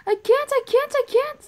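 high, loud shrieks; panicked screaming. Pretend. Monster attacking.

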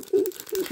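high, soft imitated whimper. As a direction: mimic a kid's muffled whimpers. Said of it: "kinda, like, whimpering".

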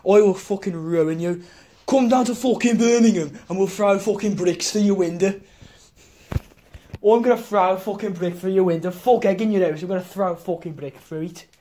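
hammed-up Brummie threat; Brummie accent. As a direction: menace him in a thick Brummie accent. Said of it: "Let me try again".

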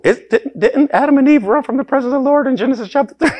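high, rushed, laughing question; laughing mock surprise. Real, not acted. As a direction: squeal the obvious through a laugh.

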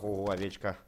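ordinary talk, nothing marked

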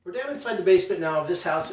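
normal talking voice

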